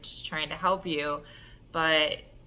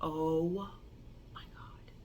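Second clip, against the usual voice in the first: stunned whisper; 4 semitones lower, 11 dB quieter, slower than usual. Retold or imitated. Stunned on hearing dog.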